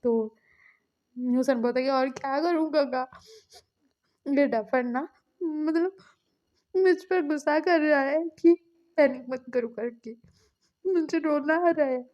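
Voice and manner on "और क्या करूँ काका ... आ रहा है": higher, mildly tearful whine; mock crying. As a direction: tattle as if close to tears. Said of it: "बोल के वो रो रहा था". (रुआँसी आवाज़ में हल्की शिकायत)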